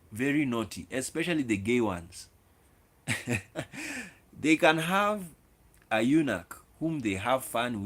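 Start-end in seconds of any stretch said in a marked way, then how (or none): 3.1-4.1 amused laugh. Real, not acted. Laughs at naughty kings remark.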